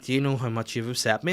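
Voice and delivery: ordinary talk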